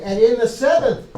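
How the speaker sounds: emphatic lawgiver. Acted. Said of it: "he does the same thing".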